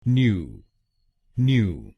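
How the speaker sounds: न्यू said as a clear "nyoo"; syllable nyoo. Shown as a demonstration. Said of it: "पहले शब्दांश को यानी एनयू हम बोलेंगे". (पहला शब्दांश न्यू)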